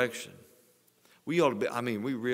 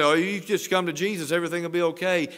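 mocking glib promise; 8 semitones higher, 8 dB louder, faster than usual. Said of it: "People say".

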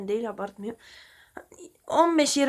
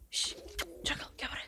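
hushed whisper calling the dog; 14 dB quieter, faster than usual. Real, not acted. Owner calling his dog over, in a whisper. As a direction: whisper so the birds stay calm.

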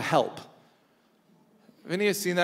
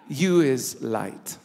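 Jesus in the nanny's manner; 5 semitones lower, slower than usual. Retold or imitated. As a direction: say each line slowly, lovingly, like Abilene. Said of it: "I hear him saying it like that".

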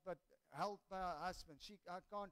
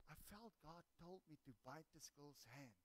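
very hushed, lowered voice; imitating quiet aside; 5 semitones lower, 15 dB quieter than usual. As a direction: confide it under your breath, as a retold secret.

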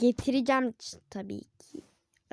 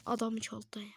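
breathy whispered aside; 3 semitones lower, 9 dB quieter than usual. Real, not acted. Kid gamer whispering to himself about a thief. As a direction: whisper it under your breath.

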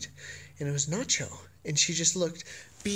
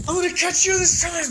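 much higher, loud teasing sing-song; squealy playful chase; 13 semitones higher, 7 dB louder than usual. Real, not acted. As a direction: squeal it at the dog like a game. Playfully chasing the dog.

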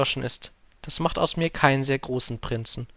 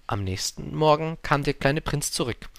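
usual speaking voice

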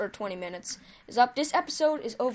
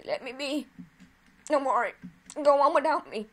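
mock dying soldier; 4 semitones higher, 4 dB louder than usual. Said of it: "He wanted to say".

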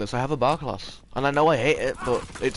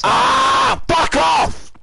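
high-pitched scream of frustration; sudden high, furious yell; enraged shout; 7 semitones higher, much slower than usual. Real, not acted. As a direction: explode with rage. Outburst after dying in game.

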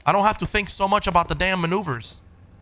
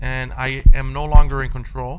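dramatic narration; 7 dB louder than usual. Pretend. Dramatic final walk narration.

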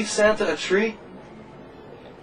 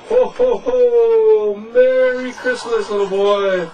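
loud, hearty ho ho ho; jolly Santa Claus; 4 semitones higher, 6 dB louder, slower than usual. Acted. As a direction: boom out Santa's merry greeting. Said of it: "And he'll go".

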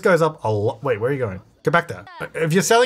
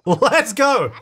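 high, thrilled cheer; jubilant, pitched-up whoop; excited celebration; 9 semitones higher than usual. Real, not acted. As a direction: celebrate a big milestone, voice leaping up. Celebrates reaching a money milestone.

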